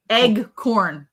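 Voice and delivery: "Eggcorn" with a short "egg" first syllable; mispronounced acorn. Shown as a demonstration. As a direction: say "Eggcorn" as egg plus corn. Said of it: "Not eggcorn".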